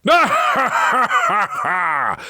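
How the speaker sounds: high hammed-up laugh of a big knight; mock laugh. A narrator's reading. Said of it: "laughed Sir Roderick".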